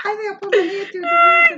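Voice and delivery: high, loud laughing mock line; laughing shout. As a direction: cry it out through laughter. Mocking character, laughing shout.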